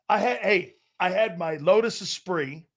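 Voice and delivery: ordinary talk, nothing marked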